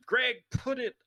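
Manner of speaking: women begging Greg to take his mask off, mock-pleading. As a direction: beg him to take it off, lightly camp. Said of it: "All the women in the world are going".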